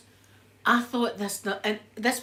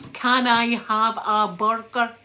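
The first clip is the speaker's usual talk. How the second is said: slow and louder, spelling it out for a foreign waiter; slow, over-clear ordering; 7 dB louder, slower than usual. Shown as a demonstration. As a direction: order a burger slowly and loudly, word by word. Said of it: "I've got to do that for them to understand me".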